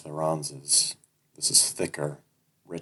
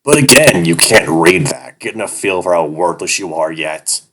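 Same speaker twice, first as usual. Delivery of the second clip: louder, mocking sneer at a worthless boy; taunting; 12 dB louder than usual. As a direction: rub his nose in it, louder. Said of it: "he taunts".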